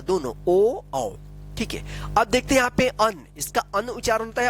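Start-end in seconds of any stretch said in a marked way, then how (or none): 0.0-1.1 ओ then औ, teacher spelling out the difference; vowel contrast o and au. Shown as a demonstration. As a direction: contrast ओ and औ clearly, as a short pure o then a gliding au. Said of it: "ओ की मात्रा".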